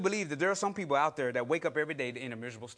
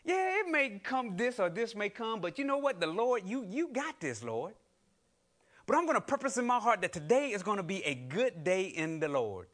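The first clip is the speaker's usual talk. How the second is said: bright, lifted voice with high starts; cheerful determined self-talk; upbeat believer; 5 semitones higher than usual. Retold or imitated. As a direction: sing out a hopeful morning vow. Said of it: "and say".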